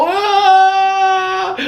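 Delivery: high sung squeal of glee; excited shriek. Retold or imitated. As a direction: squeal like a giddy fan. Retelling comic scene, shrieks.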